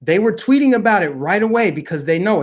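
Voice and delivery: ordinary delivery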